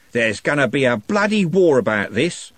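hard, loud menacing thug voice. A narrator's reading. Gang leader Andy threatening Trev, menacing. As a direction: threaten him with a war.

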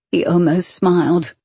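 usual speaking voice

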